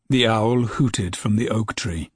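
usual speaking voice

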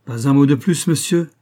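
loud menacing warning to a mocking master; furious outcry. A narrator's reading. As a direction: forbid him to say another word. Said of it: "s'écria-t-il".

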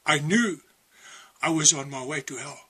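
usual speaking voice

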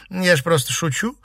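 high, light brush-off; dismissive. A narrator's reading. Girl brushing off a reproach from her cousin, dismissive. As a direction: wave it away as a joke. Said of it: "отмахнулась Сима".

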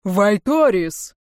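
booming name, drawn out slowly; thundering call. A narrator's reading. Voice thundering across to the castle, booming. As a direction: thunder it out, stretching the vowels. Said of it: "прогрохотал голос".

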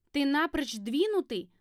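outraged accusation; indignant. A narrator's reading. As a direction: snap it out in outrage. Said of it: "возмутилась я".

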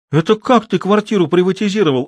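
high, astonished question; genuinely taken aback; surprised. A narrator's reading. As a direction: chief doctor amazed at the news. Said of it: "удивился главврач".

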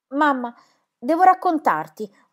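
put-on girl rushing in, calling for her mother; urgent call. Girl bursts home calling mother.

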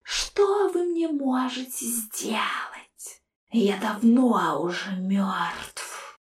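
soft, slow spectral sneer; whispered ghostly scorn; mocking ghost. A narrator's reading. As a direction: taunt them from inside the jar. Ghost skull voice.

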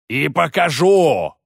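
drawn-out shouted vow; priest shouts. Acted. Priest answers the elder's challenge, shouting. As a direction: hurl it back at the elder. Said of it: "крикнул жрец".

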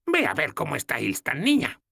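voiced little old woman, amused; old goddess's voice. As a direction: tease the kissing couple. Logna voiced.

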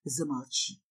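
very low, soft command; low, restrained man's plea; whispered. Acted. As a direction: hush her in a low murmur. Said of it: "шепчет он".

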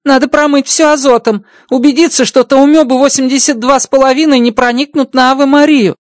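high alien voice, businesslike; the alien engineer planning, matter-of-fact; high-voiced alien sorting out the cleanup; Rocky's alien voice. Rocky explains the task.

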